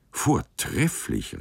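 a high, slow, admiring "Vortrefflich"; delighted exclamation. A narrator's reading. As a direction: savour the praise, drawn out and high. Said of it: "rief sie".

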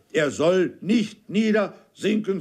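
slow, high and firm, every word set down; resolute. A narrator's reading. Uncle Toby overrules the corporal, resolute and slow. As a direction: refuse to give ground. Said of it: "ganz entschlossen".

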